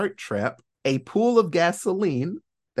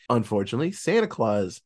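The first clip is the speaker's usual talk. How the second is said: ordinary delivery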